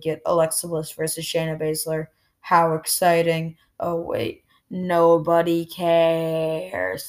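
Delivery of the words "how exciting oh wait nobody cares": slow, mock-enthused deadpan; drawn-out sarcastic dismissal. Real, not acted. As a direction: stretch the last words right out, dripping with indifference. Sarcasm about a dull match.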